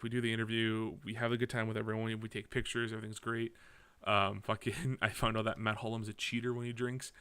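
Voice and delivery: ordinary talk, nothing marked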